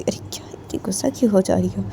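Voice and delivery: hushed, near-whispered question; gentle questioning. Acted. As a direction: ask softly why they're upset. Soothing the angry friend. (दबी, धीमी आवाज़ में पूछना)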